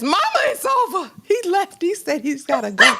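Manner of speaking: high-pitched mock wail to mama; tearful daughter. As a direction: act out a distraught daughter, voice shooting up. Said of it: "Tears already coming down her face".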